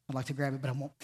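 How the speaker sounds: dropped right down, almost under the breath; a quiet throwaway aside to the room; softly, half to himself; muttered aside. Real, not acted. Aside, much softer.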